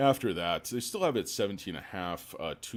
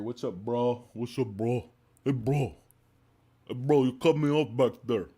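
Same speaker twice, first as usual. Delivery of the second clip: higher, much louder bro voice; bro impression; 7 semitones higher, 11 dB louder than usual. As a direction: play an angry bro calling out a driver. Acting a bro confrontation.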